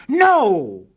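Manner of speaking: loud high cry of no; crowd shouting no. A narrator's reading. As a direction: shout it like the whole store. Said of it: "All the people in the store shouted".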